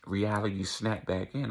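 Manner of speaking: ordinary talk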